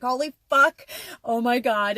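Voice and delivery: loud, high exclamation; sudden thrilled eureka; excited outburst. Retold or imitated. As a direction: blurt it out thrilled. Sudden inspiration strikes her.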